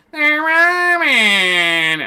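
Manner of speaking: very high, very slow mocking wail. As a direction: squeal it out in ridicule. Mocking Wick repeats.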